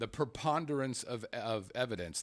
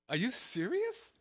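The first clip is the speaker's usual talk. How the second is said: disbelief; 11 semitones higher, slower than usual. Retold or imitated. Jurors react to absurd answer.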